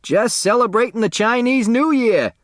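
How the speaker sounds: loud high gleeful shout; a big high hollered joke. Acted. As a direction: holler it out cheerfully. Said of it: "Otis Amber shouted".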